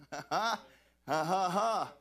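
belly laugh, loud; big joyful preacher laugh; loud hearty laugh. Real, not acted. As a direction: laugh out hard at the joke. Laughs at Gail's joke.

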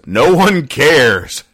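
slow, flat-out dismissive; deadpan put-down. Retold or imitated. Wife's cutting reply in comic.

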